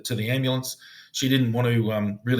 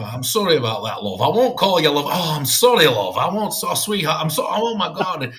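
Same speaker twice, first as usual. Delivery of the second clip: imitated Yorkshireman tripping over apologies, higher and louder; flustered, raised-pitch mock apology; flustered apologising; 6 semitones higher, 6 dB louder than usual. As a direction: blurt out apologies and keep catching yourself. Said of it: "he couldn't do it because he always resorted".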